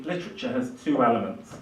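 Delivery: ordinary delivery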